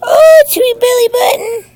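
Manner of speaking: high, loud delighted squeal; delighted coo. Real, not acted. Mother delighted by her baby's belly button, high loud squeal.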